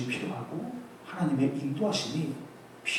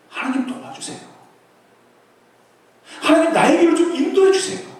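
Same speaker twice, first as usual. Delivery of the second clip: loud, higher, pleading prayer; crying out prayer; 13 semitones higher, 14 dB louder than usual. Shown as a demonstration. Preacher modelling a prayer for the youth, loud and pleading. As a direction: call out to God with all you have. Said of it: "하나님 앞에 기도하세요".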